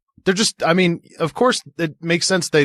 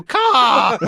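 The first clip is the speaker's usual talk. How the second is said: high caw; crow caw; 8 semitones higher, 3 dB louder than usual. Retold or imitated. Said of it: "they go".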